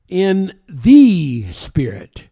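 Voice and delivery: THE drawn out and loud before "Spirit"; stress on THE. Shown as a demonstration. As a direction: lean hard and slow on "the". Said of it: "That's a definite article".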